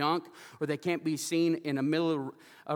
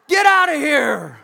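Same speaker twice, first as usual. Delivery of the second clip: retold indignant yell; 14 semitones higher, 12 dB louder than usual. Veteran ordering a lady out of the bathroom, hammed-up shout. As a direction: yell it out long and loud, imitating the veteran.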